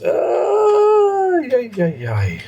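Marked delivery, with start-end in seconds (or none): 0.0-1.4 squeal. Acted. Squeal at cute question.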